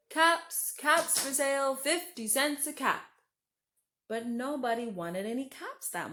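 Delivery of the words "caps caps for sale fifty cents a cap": high, loud street cry; calling out wares. A narrator's reading. Peddler calling to buyers, loud street cry. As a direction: sing out to the whole street.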